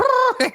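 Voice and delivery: very high, loud mocking trill; mocking noise. Joker making fun of a fallen man, high mocking noise. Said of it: "makes fun of him".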